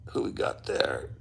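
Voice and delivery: hushed low murmur; whispering. Real, not acted. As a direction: breathe the question quietly. Reading a viewer's name.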